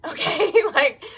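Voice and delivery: laughing okay. Real, not acted. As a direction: laugh it out as a question. Laughs at her own joke.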